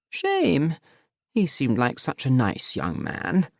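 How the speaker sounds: mother making a cutting remark slowly; disapproving mother. Acted. Mother eyeing her son's boyfriend, slow and pointed.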